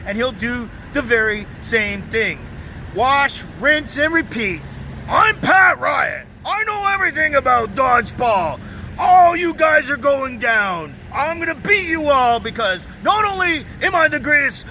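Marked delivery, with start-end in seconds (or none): 5.1-14.6 strut through it like a know-it-all; mock cocky loudmouth taunting the team; boastful braggart impression. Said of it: "mocking rival's boast".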